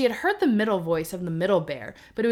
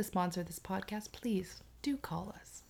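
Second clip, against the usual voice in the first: whisper; 14 dB quieter than usual. Pretend. Mock sponsor plea, whispered.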